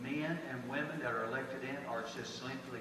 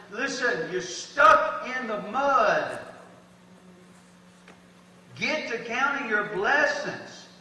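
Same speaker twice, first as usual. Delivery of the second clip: loud, high preaching from the pulpit; full-force preaching; 6 semitones higher, 11 dB louder than usual. Acted. Pastor urging the church to count blessings, full-force preaching. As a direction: pastor preaching hard to the congregation.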